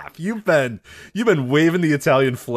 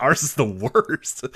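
mock dismay; much slower than usual. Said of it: "and you're like".

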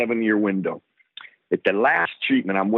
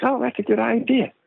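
high sing-song sarcastic agreement; mock agreement; 14 semitones higher than usual. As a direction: chirp it back like a cheeky kid. Agreeing to check aorta.

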